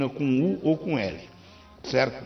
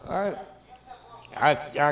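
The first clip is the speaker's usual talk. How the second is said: ordinary delivery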